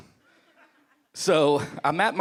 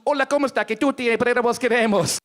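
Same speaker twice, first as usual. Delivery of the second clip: higher, louder mock Spanish accent; playful mock Spanish accent, pitched up; 7 semitones higher, 4 dB louder than usual. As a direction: ham up a fake Spanish accent. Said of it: "they would have sounded like this".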